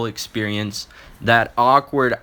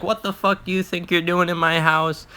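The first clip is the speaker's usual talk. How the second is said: imitating pissed-off mom; 5 semitones higher than usual. Mom confronting her son in her house, mock angry scolding. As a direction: play an angry mom's rant without yelling. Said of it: "my mom is just fucking pissed".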